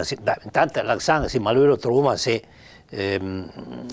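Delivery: ordinary delivery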